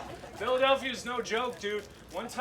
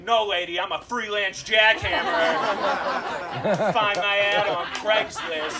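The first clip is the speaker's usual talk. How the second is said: much louder cheeky comeback; loud sassy retort; 8 dB louder than usual. Retold or imitated. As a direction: fire back loud and cheeky.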